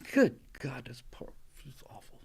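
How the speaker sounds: hushed, breathy disgust; whispered disgust. Real, not acted. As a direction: whisper it in despair. Disgusted aside.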